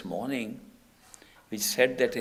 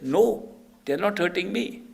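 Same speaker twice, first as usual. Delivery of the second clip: acted distressed denial; 7 semitones higher, 5 dB louder than usual. Disciple answers from hell.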